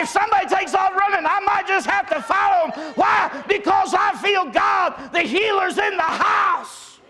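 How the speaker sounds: high, loud, fervent sermon shout; preaching at full force. Acted. Preacher rousing his congregation about Jesus, shouting high and loud. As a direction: build and ring it out to the church.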